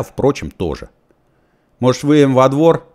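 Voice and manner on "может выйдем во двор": businesslike. A narrator's reading. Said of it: "деловито предложил я".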